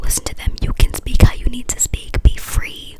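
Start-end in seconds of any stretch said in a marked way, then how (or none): none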